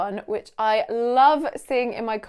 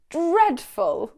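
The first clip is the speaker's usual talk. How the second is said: disgusted; 6 semitones higher, 5 dB louder than usual. Shown as a demonstration. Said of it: "show absolute disgust".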